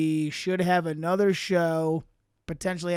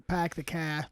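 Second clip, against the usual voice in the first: Boston-style flattened vowels in "pack the car"; badly faked Boston "pack the car"; attempted Boston accent; 2 semitones lower, 5 dB quieter than usual. Shown as a demonstration. Showing friends how Boston vowels go, faulty Boston accent. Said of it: "You gotta get the I's".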